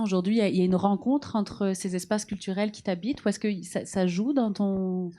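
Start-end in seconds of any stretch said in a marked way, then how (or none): none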